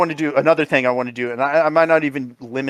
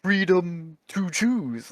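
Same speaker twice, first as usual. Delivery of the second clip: slow, syllable by syllable, softer; overpronounced syllables; 3 semitones higher, 6 dB quieter, much slower than usual. Retold or imitated. Tapping a friend while mimicking the movie, slow syllables. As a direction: tap out each syllable of "Freedom to choose". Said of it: "I'm tapping Hannah on all their syllables".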